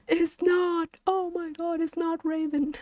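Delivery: very high, squeaky laughing protest; high amused squeal. Real, not acted. Streamer reacting to chat about Raven, high giggly squeal.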